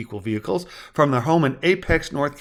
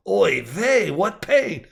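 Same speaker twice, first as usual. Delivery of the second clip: put-on NYC accent, pitched up; New York accent; 5 semitones higher, much slower than usual. A narrator's reading. Husband joking to his wife, mock New York accent. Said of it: "assumed an N Y C accent".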